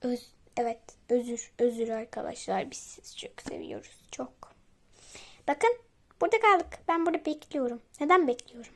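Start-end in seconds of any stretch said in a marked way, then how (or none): 2.7-4.3 soft breathy secret whisper. Real, not acted. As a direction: whisper it close to the mic. Whisper aside to viewers. (fısıldayarak)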